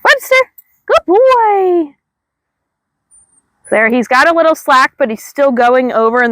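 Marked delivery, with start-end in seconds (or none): 0.0-1.9 calling the dog. Real, not acted. Trainer calls her dog Webster, high excited call and praise. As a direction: sing out his name, then praise.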